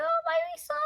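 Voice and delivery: very high, tender near-tearful cry. Retold or imitated. Cuddling her newborn son, a very high tearful coo. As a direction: coo it through happy tears. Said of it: "crying a bit too".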